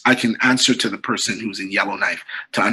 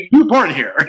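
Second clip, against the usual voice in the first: laughing disbelief; 9 semitones higher, 5 dB louder than usual. Retold or imitated.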